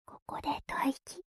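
breathy whisper into the ear. Acted. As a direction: whisper it playfully, close up.

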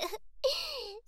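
high, delighted giggle. Real, not acted. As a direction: laugh with glee at the comments. Gleeful at viewers' comments.

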